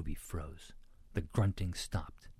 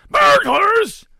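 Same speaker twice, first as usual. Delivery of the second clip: very high, very loud alarmed cry; shriek; 21 dB louder than usual. A narrator's reading. Pig family hears of burglars, shrieking. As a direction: shriek it like panicked pigs. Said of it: "shrieked the Sniffs".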